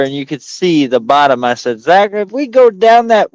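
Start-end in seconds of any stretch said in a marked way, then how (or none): 1.8-3.3 high-pitched, urgent retold warning; worked-up storytelling panic; alarmed warning. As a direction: warn the passenger in mock alarm. Re-enacts warning his passenger.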